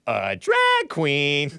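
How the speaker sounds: slow sassy drag queen voice; [name] impression. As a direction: stretch it out like a diva. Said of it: "sounds just like I'm meeting [name] himself".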